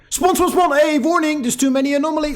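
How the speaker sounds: high, loud, rapid-fire mock of the game. Gamer mocking the game's spawn spam, high and loud. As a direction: parody the game spamming spawns and warnings. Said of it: "Like the game".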